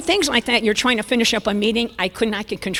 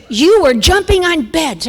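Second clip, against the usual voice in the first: high, loud, slow accusation; mock scolding; 7 semitones higher, 9 dB louder, slower than usual. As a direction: imitate an exasperated husband scolding. Husband accuses her in retold quarrel.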